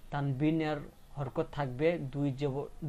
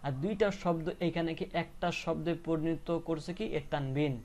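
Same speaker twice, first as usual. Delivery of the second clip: ordinary talk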